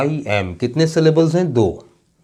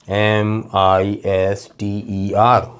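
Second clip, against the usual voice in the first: एम आई एस टी ई आर slowly, letter by letter; a gap after each letter of एम आई एस टी ई आर; spelling out; 3 semitones lower, much slower than usual. Shown as a demonstration. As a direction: spell the word out one letter at a time. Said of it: "जबकि पूरा word है".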